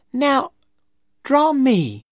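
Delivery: put-on high, slow girlish request; eager little girl. A narrator's reading. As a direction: ask slowly in a high girl's voice. Becky asks to be drawn.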